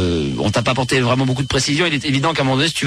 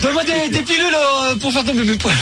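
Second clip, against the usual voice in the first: mock sheepish customer; 10 semitones higher than usual. Host mocking a hooded man at the pharmacy, high put-on voice. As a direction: play a furtive guy whispering his order at the counter, pitched way up.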